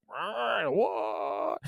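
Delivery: a quiet, moaning zombie-like "What?"; zombie groan. Retold or imitated. Retelling the zombie-like man on Fairfax, quiet moaning zombie voice. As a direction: drag the word out like a dazed zombie.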